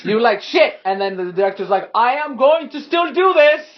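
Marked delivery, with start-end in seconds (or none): 0.4-0.8 higher, sudden acted expletive; startled curse. Game master voices the flung character, startled cursing. 1.9-3.7 high, loud hammed-up resolve; defiant declaration. Director insists he will go on, loudly defiant.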